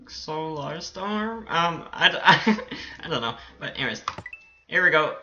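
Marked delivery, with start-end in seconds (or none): 0.0-1.5 very slow, softer, drawn-out name; unsure sounding out. Real, not acted. Gamer attempting an unfamiliar creator's name, unsure sounding out. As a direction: stretch each syllable, feeling out the name. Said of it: "I can't pronounce your name".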